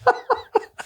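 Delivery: high squealing laugh breaking out; burst of laughter. Real, not acted. Host laughing about Jill's takedown, high real laugh. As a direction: crack up at the memory.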